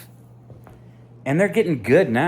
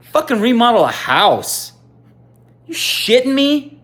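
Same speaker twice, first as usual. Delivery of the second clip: incredulous rant; 11 semitones higher, 7 dB louder than usual. Real, not acted. Ranting at restaurant-work lecturers.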